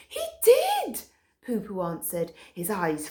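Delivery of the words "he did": very high grave dog voice; high grave Poo Poo. A narrator's reading. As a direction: confirm it solemnly. Poo Poo confirms.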